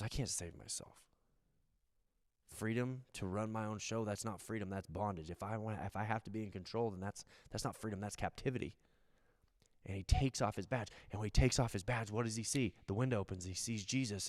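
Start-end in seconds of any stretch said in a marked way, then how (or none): 0.0-8.7 low, hushed admission of captivity; soft confession. Retold or imitated. Thief realizing he cannot save himself, hushed. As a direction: speak it quietly, almost to yourself. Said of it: "he goes".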